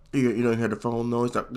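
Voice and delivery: normal talking voice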